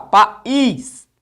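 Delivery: "país" with the last syllable punched; loud, stretched ís at the end of "país"; stress on ÍS. Shown as a demonstration. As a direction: split pa from ís and hit the ís in "país". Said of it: "A sílaba tônica tá bem aqui".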